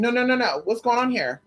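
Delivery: ordinary delivery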